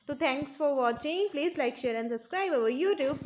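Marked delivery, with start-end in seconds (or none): none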